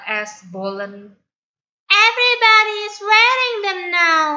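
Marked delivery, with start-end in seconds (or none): 2.1-4.3 much higher, fast, loud lilting voice; sing-song girl. Acted. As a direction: gush about the trend in sing-song. Stella enthusing, sing-song.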